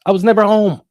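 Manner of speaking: loud, high-pitched burst; emphatic exclamation. Real, not acted. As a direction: raise the voice sharply to drive it home. Stressing his constant absence.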